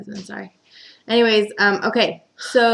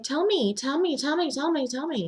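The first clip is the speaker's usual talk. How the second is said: high nagging plea; 8 semitones higher, 4 dB quieter than usual. Retold or imitated. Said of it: "she's like".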